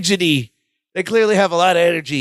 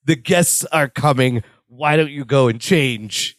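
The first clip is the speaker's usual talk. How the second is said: weary brush-off; 6 semitones lower than usual. Retold or imitated. Jennifer weary, voiced lower.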